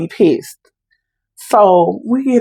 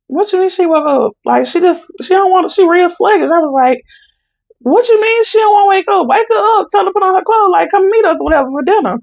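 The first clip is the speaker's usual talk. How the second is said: much higher, insistent disbelief; alarmed urgency; 11 semitones higher than usual. Retold or imitated. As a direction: push the aunt to wake her up now. Alarmed reply to aunt about mom.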